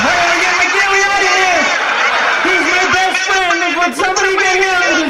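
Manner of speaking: high, hammed-up cries for help; frantic battlefield yelling. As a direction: yell desperately for someone to save your friend. Mock panicked cries for wounded friend.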